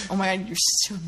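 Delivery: usual speaking voice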